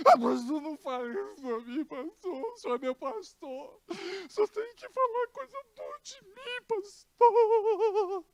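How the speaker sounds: high, whiny emotional blackmail; hammed-up whimper at the pastor; dramatic whining. Sister guilt-tripping her pastor, whiny high plea. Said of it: "Aquele drama, aquela chantagem emocional".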